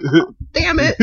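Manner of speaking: very high yelp; high squeak of dismay. Retold or imitated. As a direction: yelp in alarm. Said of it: "And I'm like".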